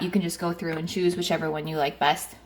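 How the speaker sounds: normal talking voice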